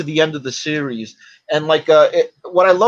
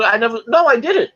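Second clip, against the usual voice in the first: high-pitched protesting denial; retold fib, pitched up in protest; Shatner's mock denial; 7 semitones higher, 3 dB louder than usual. Shatner denying he took the wig home, high mock protest. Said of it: "they went to Shatner".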